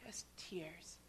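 breathless whisper; barely a breath of a whisper. Real, not acted. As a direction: whisper it, moved. His tears after the message.

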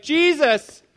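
high, full-voiced yell; shouting. Blind beggar calling to Jesus as he passes, shouting. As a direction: cry it out loud over the crowd. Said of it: "he shouts, he yells".

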